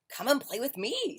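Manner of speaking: pig's high, bright invitation; cheerful pig. Acted. Pig calling Duck to play, high voice. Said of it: "said Pig".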